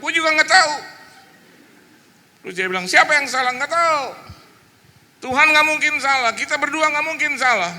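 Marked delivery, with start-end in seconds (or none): 0.0-0.7 high, loud protest; retold exasperated sibling shout; shouted retort. Shouted reply in retold sibling quarrel. 2.9-7.7 very high, loud protesting; retold heated sibling quarrel; shouted argument.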